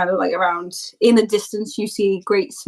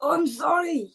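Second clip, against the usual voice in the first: higher-pitched in-character sorry; put-on apologetic character voice; mock apology; 8 semitones higher, 4 dB quieter than usual. Old man retorts in mock apology.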